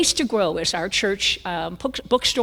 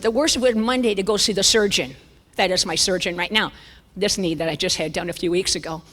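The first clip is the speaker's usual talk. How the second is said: ordinary talk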